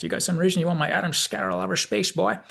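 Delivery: put-on quick old-timer's Southern voice; Southern McCoy. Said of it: "'cause McCoy just asked".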